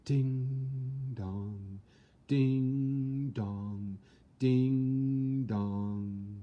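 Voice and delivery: long, sustained ding dong like a ship's bell; mimicking the ship's bell; bell chimes. A narrator's reading. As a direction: ring it out like a great bell. Narrator imitates ship's bell.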